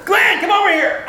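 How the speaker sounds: high, loud call across a room; student spotting Glenn and hailing him. Retold or imitated.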